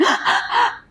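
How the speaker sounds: quick high breathy sigh; short exhale a child makes to calm down; settling sigh. Shown as a demonstration. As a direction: demo the calming breath again. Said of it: "You ever seen anybody, a child do that?".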